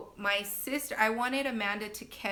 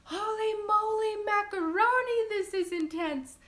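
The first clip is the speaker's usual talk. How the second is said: retold yell; 13 semitones higher, 6 dB louder than usual. Labouring mom just before pushing, shocked yell. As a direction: yell it out as an overwhelmed exclamation. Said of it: "I just yell out".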